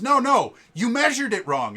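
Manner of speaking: high, loud retold yell of denial; angry protest. Contestant yelling at judges about measuring, high and loud. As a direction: shout him down as the wronged smith. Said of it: "he goes".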